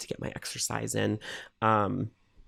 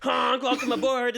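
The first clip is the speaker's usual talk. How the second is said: laughing honk; 13 semitones higher than usual. Real, not acted. Goose honk joke.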